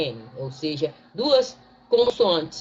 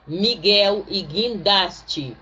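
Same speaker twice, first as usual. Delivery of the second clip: slow word demo; much slower than usual. Shown as a demonstration. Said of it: "nós não falamos Miguel".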